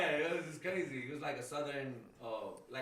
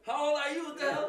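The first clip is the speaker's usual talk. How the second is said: much higher, louder, laughing disbelief; incredulous brother; 13 semitones higher, 6 dB louder than usual. Retold or imitated. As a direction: laugh through a mock-outraged question. Brother mocking his age.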